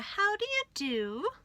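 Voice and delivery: high, cheery duck voice; a polite little duck says hello; duck greeting. A narrator's reading. Duck stops on the road and greets the rat brightly.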